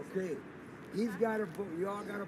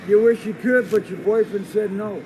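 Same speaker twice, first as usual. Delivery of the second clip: much louder mocking yell; yelled taunt; 4 semitones higher, 17 dB louder than usual. Real, not acted. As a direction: shout the insult after him. Taunting the departing man.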